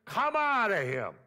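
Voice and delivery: high, loud command to the demon; stern command. A narrator's reading. Jesus commands the evil spirit to leave him, sternly. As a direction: order the spirit out firmly. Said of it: "Jesus said sternly".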